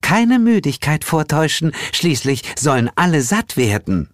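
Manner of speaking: silky, high-voiced hostess; velvety woman's voice. A narrator's reading. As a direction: chivvy the guests along in a velvety high voice. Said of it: "mit samtweicher Stimme".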